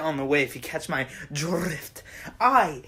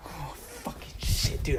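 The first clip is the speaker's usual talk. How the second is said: whispered curse; 2 semitones lower, 5 dB quieter, slower than usual. Real, not acted. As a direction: hiss it under your breath. Mock outrage.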